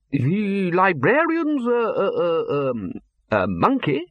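high, slow, halting bafflement; stammering disbelief. Acted. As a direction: stumble over the idea. Arch-Chancellor baffled.

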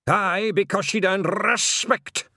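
high, loud Scottish voice; slow, emphatic Scots, pitched high; rolled-r Scottish character voice; William the Feegle. Acted.

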